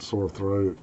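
ordinary delivery

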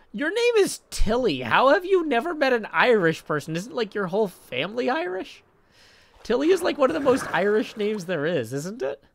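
loud, high, swooping mock Irish; incredulous questioning in a hammed-up Irish accent. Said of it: "Why is my default accent Irish?".

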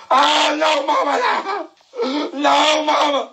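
high panicked plea; pleading kid. Retold or imitated. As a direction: beg mama not to whoop him. Begging mama.

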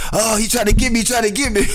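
escaping by a hair; panicked. Retold or imitated. Narrator fleeing the grabbing man, mock panic.

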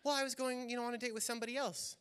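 lightly voiced wife, airy and quick; man playing a woman. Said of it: "himself playing a woman".

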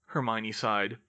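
ordinary delivery, nothing marked

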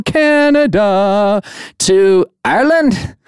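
a bad stab at an Irish accent, slowed; Irish accent attempt. Podcast host greeting Irish listeners in a weak Irish accent. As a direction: shout out Ireland in a fumbling Irish accent. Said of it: "I know that's probably Scottish".

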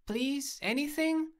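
very high, rising plea; pleading try. A narrator's reading. Trying names on an ignoring dog, high pleading. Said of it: "I tried".

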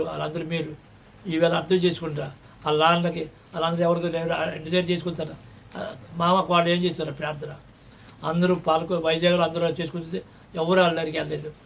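normal talking voice

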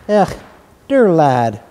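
trembling, tearful mother; tremulous. A narrator's reading. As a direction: voice wavering with feeling. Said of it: "she broke out tremulously".

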